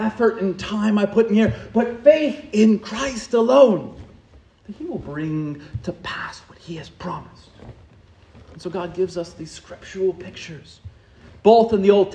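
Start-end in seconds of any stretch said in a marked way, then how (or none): 4.7-7.5 hushed, breathy promise; whisper. Real, not acted. Preacher drops to intimate whisper.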